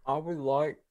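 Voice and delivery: talking through chocolate; mouth full. Real, not acted. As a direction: speak around a mouthful of chocolate. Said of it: "sorry, I'm eating chocolate".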